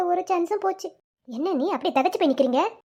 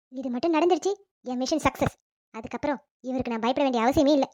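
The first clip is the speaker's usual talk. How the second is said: normal talking voice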